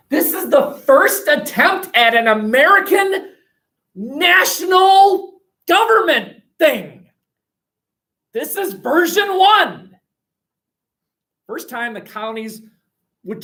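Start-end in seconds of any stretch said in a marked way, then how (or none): 0.1-10.0 higher and louder, pushing the big idea; lively teacher enthusiasm, higher and louder; excited. Real, not acted. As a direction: build excitement about the first union. Excited lecture climax.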